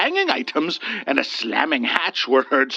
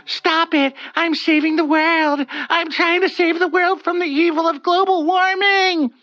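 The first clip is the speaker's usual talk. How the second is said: high desperate screams, pitched way up; screaming; 13 semitones higher, 3 dB louder than usual. Acted. Olga screaming at the wood figure, desperate. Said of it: "She screamed out to the infeasible figure".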